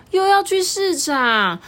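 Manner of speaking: high, slow dismayed cry; dismayed surprise. Acted. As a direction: groan at going again. Tianmi dismayed.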